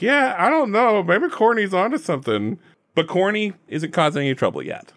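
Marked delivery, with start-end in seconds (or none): 0.0-2.6 higher, unsure musing voice; voicing Stu. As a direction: play the doubting shopkeeper. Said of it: "and you're like".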